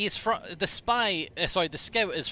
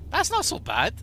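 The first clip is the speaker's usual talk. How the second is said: higher, louder playful repeat; higher mock take; 11 semitones higher, 5 dB louder than usual. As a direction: say it again, pitched way up in character. Repeats line in character voice.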